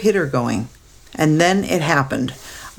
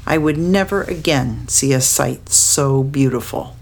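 plain reading voice

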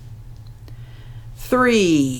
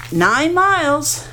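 grandma voice; 10 semitones higher, slower than usual. A narrator's reading.

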